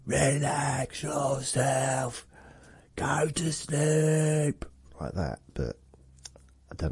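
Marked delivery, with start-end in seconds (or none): 0.0-4.6 high, slow, drawn-out hypnotist call; hypnosis shouting. Shown as a demonstration. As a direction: boom a hypnotist command slowly. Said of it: "I thought about doing some hypnosis shouting".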